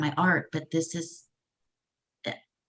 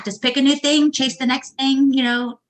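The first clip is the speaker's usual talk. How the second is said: high, loud playful echo; mock breezy; 7 semitones higher, 5 dB louder than usual. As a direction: echo the tease with a grin. Echoing the tease back.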